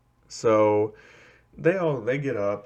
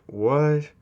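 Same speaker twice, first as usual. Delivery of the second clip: higher, rising baffled question; baffled disbelief; 5 semitones higher, 3 dB louder than usual. Acted. Students react to the teacher's abrupt orders, baffled. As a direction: ask it in disbelief.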